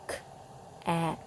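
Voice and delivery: "k-at" whispered, joined together; soft unvoiced "k-at". Shown as a demonstration. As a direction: breathe "k-at" without a gap. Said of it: "There's not that break".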